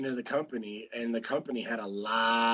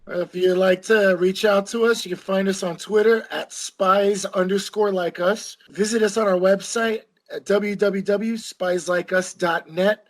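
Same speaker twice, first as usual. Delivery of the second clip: louder, projected outro announcement; podcast sign-off; 6 semitones higher, 9 dB louder than usual. Real, not acted. Host asks listeners for reviews, projected podcast sign-off. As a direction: address the listeners clearly and upbeat.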